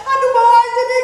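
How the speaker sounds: high, loud mock wail; tearful panic. Wife at home urging hospital trip, mock-tearful panic. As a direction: imitate a frantic wife pleading loudly. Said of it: "Waktu di rumah saya nangis-nangis".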